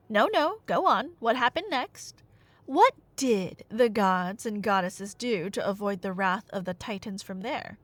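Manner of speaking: ordinary delivery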